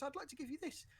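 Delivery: high, sweet villager voice; chirpy gift-giving villager; cheerful villager. Retold or imitated.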